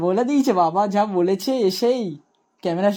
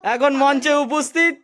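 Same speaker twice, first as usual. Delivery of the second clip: announcer; 4 semitones higher, slower than usual. Pretend. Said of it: "announcing on stage".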